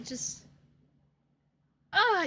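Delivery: usual speaking voice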